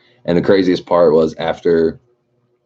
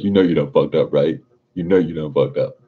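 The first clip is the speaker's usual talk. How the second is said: imitating Old School. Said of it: "Old School says".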